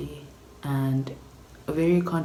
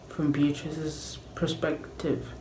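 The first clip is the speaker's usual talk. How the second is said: ordinary talk, nothing marked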